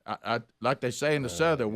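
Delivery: ordinary delivery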